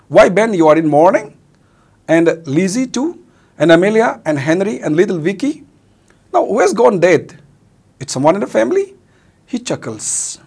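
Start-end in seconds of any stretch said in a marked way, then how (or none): 0.1-8.9 higher and louder, alarmed questions; an old man shocked to see everyone in mourning; startled. Acted. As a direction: ask in sudden alarm.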